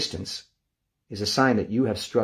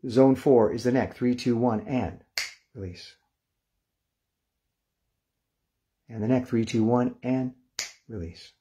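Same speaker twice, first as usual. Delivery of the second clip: guided relaxation countdown; 2 semitones higher than usual. Acted. Teacher guiding the class through muscle release, hushed and calm.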